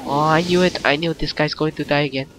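ordinary delivery

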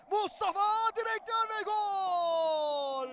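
long goal call; screaming football commentator. Real, not acted. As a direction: stretch the goal shout.

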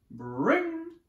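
high, loud trilled ring; piercing bell sound by voice; imitated bell. A narrator's reading.

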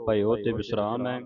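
ordinary talk, nothing marked

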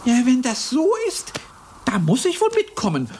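normal talking voice

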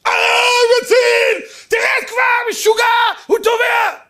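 very high, loud panicked scream; screaming mother. Retold or imitated. Mother screaming to the lifeguard that her son drowns. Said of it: "אז היא צורחת".

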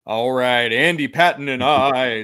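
booming mock announcer; a hint of an arena announcer. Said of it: "this is my announcer voice".

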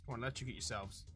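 ordinary delivery

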